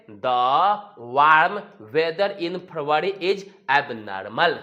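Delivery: very slow, word by word; slow dictation. Shown as a demonstration. Teacher modelling an English sentence, very slow. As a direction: spell the sentence out slowly for learners. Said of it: "तो बोलेंगे".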